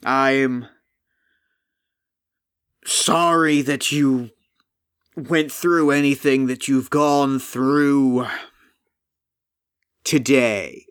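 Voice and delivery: sorrowful golem. Acted. Golem voice held.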